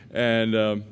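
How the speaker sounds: usual speaking voice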